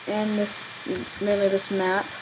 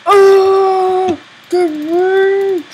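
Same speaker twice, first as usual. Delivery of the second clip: much higher, louder excited yell; excited shout; 9 semitones higher, 10 dB louder, much slower than usual. Real, not acted. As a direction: yell it out in triumph. Excited outburst at a game event.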